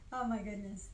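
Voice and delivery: ordinary talk, nothing marked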